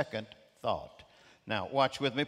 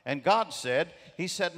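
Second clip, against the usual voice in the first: ordinary delivery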